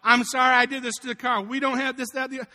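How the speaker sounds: worried wife. Retold or imitated. Wife apologizing about the wrecked car, imitated worried voice. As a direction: voice her apology in a mildly anxious rush of worry. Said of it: "She's worried about".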